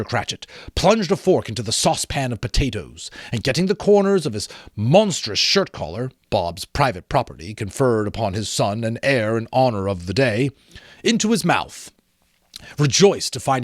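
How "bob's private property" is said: plain reading voice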